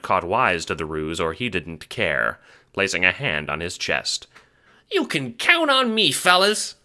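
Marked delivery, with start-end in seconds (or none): 4.9-6.8 much higher earnest little voice; earnest squeak. Acted. Henchman earnestly promising his bosses. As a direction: promise it with all your heart. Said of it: "he says earnestly".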